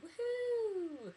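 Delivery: high, loud "woohoo" cheer; real high-pitched cheer; excited whoop. Real, not acted. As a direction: let out a long celebratory whoop. Cheering about butter chicken dinner.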